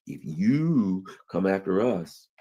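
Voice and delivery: drawn out, each word weighed; slow the threat right down; slow menacing repeat. Retold or imitated. Iran warning Trump, slow deliberate threat.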